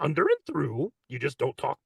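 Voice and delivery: a hint of Grover; lightly voiced muppet; light Grover impression. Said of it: "Grover is over".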